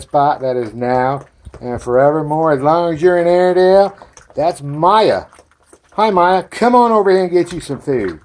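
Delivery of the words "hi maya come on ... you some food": high, loud greeting and call; calling a puppy. Real, not acted. Owner calling Maya to her food, loud high call. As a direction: call the dog over to eat, pitched up and loud.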